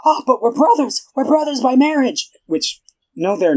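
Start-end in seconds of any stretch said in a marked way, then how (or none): 0.0-2.3 high, whiny mock pleading; much higher, imitated panicked begging; freaking out. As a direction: plead desperately, hammed up. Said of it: "he starts freaking out".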